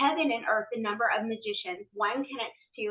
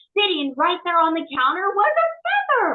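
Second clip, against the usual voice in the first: high, loud excited reveal; excited story climax; 7 semitones higher, 7 dB louder than usual. Real, not acted. Telling a friend of the found feather, excited reveal. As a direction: build to the big reveal, raised voice.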